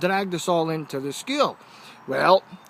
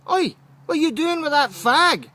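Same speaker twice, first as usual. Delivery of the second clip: calling out the smokers; 10 semitones higher, 4 dB louder than usual. Retold or imitated. Confronting the smoking boys.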